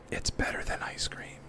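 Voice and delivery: breathy secret aside; whisper. Acted. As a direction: lean in and whisper it. Whispered aside about custard.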